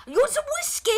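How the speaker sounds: very high pirate character call; Walcott Savage Soul Byron. Acted. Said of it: "he said, I guess they're Irish".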